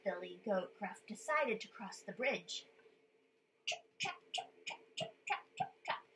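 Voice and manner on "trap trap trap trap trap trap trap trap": soft tapping "trap, trap, trap" like tiny hooves; footstep sounds. Shown as a demonstration. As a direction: tap out little goat steps. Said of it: "make the little footprint sounds".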